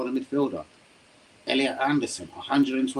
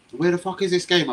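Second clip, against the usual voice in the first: frustrated outburst; 7 semitones higher, 5 dB louder than usual. Real, not acted. Stream lagging, frustrated.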